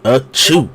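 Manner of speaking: loud playful fake sneeze. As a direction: sneeze it out for show. Fake sneeze as entrance bit.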